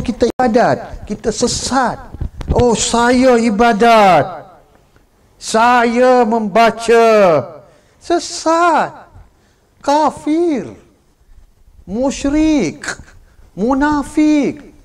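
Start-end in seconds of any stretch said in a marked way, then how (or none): 2.5-7.1 slow, higher, louder smug voice; preacher mocks a complacent worshipper, slow and smug; mocking worshipper. 8.0-14.7 much higher, each word hurled out alone; preacher denouncing the misguided, high and forceful; thundering verdicts. Acted. Preacher pronounces a string of verdicts.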